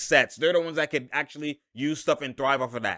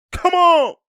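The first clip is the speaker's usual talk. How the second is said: a much higher, louder hype shout; excited shout; 14 semitones higher, 7 dB louder than usual. Real, not acted. Cheering a made shot with a high excited shout. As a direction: shout it at the screen. Hyped by the bucket.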